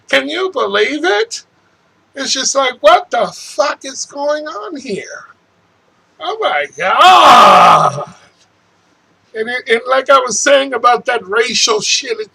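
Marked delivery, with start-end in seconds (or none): 0.1-5.2 high, exasperated disbelief. Real, not acted. Disbelieving rant about the polls. 6.2-8.1 slow, loud, high cry of exasperation; a loud, slow Oh my God; exasperated shout. Real, not acted. Exasperated outburst in rant.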